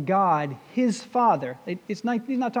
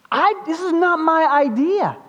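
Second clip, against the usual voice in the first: higher, louder insistent protest; emphatic disclaimer; 7 semitones higher, 5 dB louder than usual. Acted. Preacher as Jesus explaining the healing, emphatic. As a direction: insist on it forcefully.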